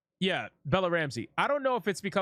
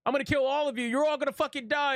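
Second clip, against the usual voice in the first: mocking threat; 8 semitones higher than usual. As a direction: sneer at her empty threats, voice pitched up. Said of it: "she's like".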